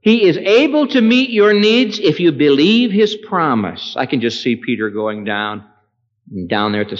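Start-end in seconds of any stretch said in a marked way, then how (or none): 0.0-4.0 high, loud declaration; fervent sermon proclamation; preaching at full force. Acted. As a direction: proclaim it to the whole church. Pulpit declaration.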